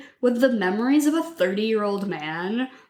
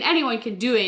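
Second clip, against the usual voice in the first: ordinary talk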